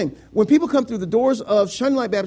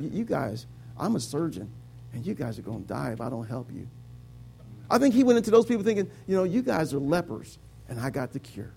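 low, soft and humble; quiet, lowered voice of humility; 7 semitones lower, 6 dB quieter than usual. Acted. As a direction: speak softly and gently, as a humble healer to the sick. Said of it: "went there with a humble heart".

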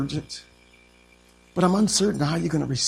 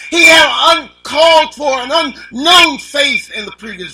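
high and loud, urgent preacher's cry; preaching at full force; 10 semitones higher, 10 dB louder than usual. Acted. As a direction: drive the urgency home to the pews.

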